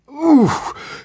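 short high grunt as force hits; strained oof. A narrator's reading. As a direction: grunt under sudden g-forces. Pressed by g-forces.

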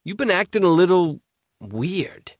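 a hint of a worried buddy; teen boy voice. Tarek voiced by reader.

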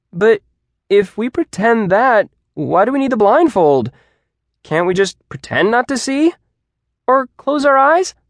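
high, loud objections; questioning student. A narrator's reading. Student picking apart the blindfold rule, high and persistent. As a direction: keep poking holes, rising into each question.

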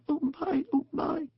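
soft, very high gasps of delight; awed gasping. A narrator's reading. Mole unpacking the picnic, gasping in wonder. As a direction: gasp it small and high, full of wonder. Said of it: "still gasping".

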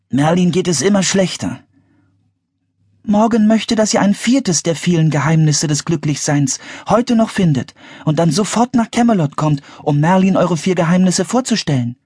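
put-on high-pitched urgent young wizard; Teddy, urgent. A narrator's reading. (hohe, dringliche Zaubererstimme)

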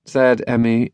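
usual speaking voice

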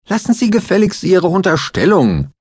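very high, loud offended protest; indignant rebuke. A narrator's reading. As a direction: bristle at the insult. Lawyer angrily rejects accusation.

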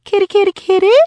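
high, loud sing-song cat call; old woman calling her frightened cat. A narrator's reading. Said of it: "She started calling for Pasha".

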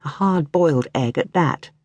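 usual speaking voice

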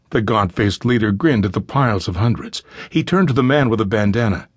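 ordinary delivery

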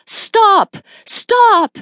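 high, loud cries of stop; urgent shout. A narrator's reading. Racing toward the lifeboat to halt it, shouting.

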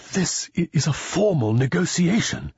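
high, stammering alarm, fully voiced; shaky whisper. A narrator's reading. Alan warns Papa of the negotiation, shakily alarmed.

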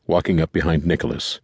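ordinary delivery, nothing marked

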